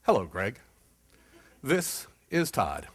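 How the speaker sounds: low, slow, drawn-out greeting; slow caller voice. Retold or imitated. Client calling back in his slow, low voice. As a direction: crawl through it like Todd. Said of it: "The voice on the other end".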